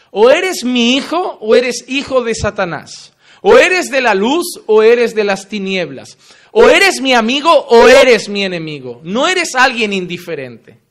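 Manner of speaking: loud, driving sermon delivery; fiery preaching. Acted. Preacher confronting his congregation, loud and forceful. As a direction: pound each contrast home like a revival preacher.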